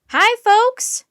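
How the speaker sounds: high, loud friendly hail; bright loud hello to the researchers; shouted greeting. A narrator's reading. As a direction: call out across the snow to the group. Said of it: "a woman shouted".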